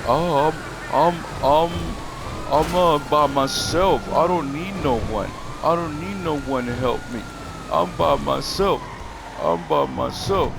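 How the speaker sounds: mocking rival. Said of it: "How you said that yourself".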